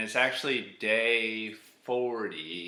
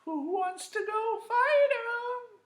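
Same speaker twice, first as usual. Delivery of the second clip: very high, slow squeaky voice; a scared king squeaking out the question; squeaky high-pitched timid king; nervous King Saul; 3 dB louder, slower than usual. Retold or imitated. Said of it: "he's like".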